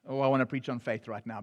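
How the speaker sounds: hurried soft aside; quick aside. Real, not acted. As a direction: toss it off under your breath. Aside under breath in sermon.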